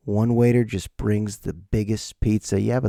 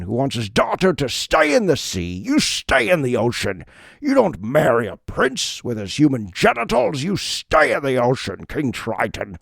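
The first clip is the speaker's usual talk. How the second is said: a put-on sea king's stern voice, lightly done; lightly voiced stern father king; King Triton voice. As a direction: boom at the daughter like an angry sea king. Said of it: "Is that how King Triton talks?".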